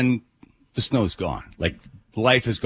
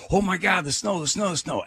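excited; 10 semitones higher than usual. Retold or imitated. Mimicking excited strangers on bus.

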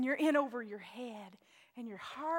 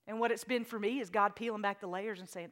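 ordinary talk

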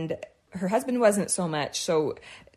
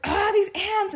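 high, fed-up sigh at the ants; mock frustration at the pests; exasperated groan; 11 semitones higher, slower than usual. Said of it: "So I was always like".

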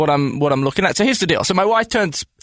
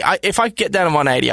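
usual speaking voice

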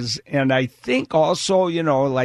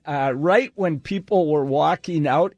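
ordinary talk

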